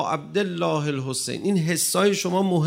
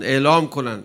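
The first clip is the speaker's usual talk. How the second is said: ordinary delivery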